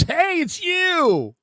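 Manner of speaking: slow, high, excited stranger's call; fan's greeting. Retold or imitated. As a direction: stretch it out like a starstruck fan. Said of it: "He goes".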